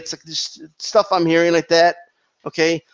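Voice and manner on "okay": ordinary talk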